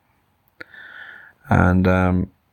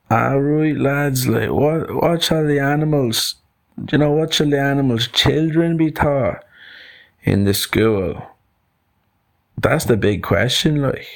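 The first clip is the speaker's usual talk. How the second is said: put-on higher-pitched Dublin accent for a monkey; 7 semitones higher than usual. Monkey opens the school meeting, higher Dublin voice. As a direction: play a Dublin monkey taking charge. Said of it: "Mister Monkey was from Dublin".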